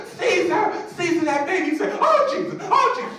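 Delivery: very high, loud ecstatic shrieks; hammed-up church-lady hollering; Holy Ghost frenzy. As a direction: imitate a woman screaming for her husband and praising. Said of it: "She kept going and going".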